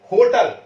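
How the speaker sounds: होटल said high and loud; pronunciation demo of होटल. Shown as a demonstration. Teacher showing pupils how the word sounds, होटल pronounced loudly. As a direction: sound out होटल firmly for learners. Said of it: "इसका pronunciation".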